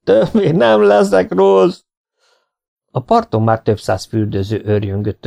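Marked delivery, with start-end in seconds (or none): 0.0-1.8 high, drawn-out wail; howled pleading. A narrator's reading. Struggling swimmer promises to behave, howling. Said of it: "üvöltötte".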